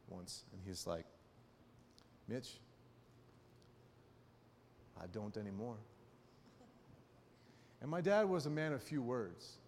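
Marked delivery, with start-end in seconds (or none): 2.3-5.8 low, quiet dad with restrained anger; understated stern dad. Retold or imitated. Mad dad answering his son Mitch, in a low, restrained voice. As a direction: imitate Dad's quietly serious reply, low and spare. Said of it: "my dad got really mad".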